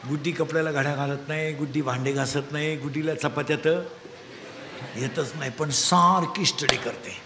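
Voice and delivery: put-on low, hushed parent praising their child; soft, low-pitched proud-parent voice; boastful parent. Acting parent excusing Guddi from chores.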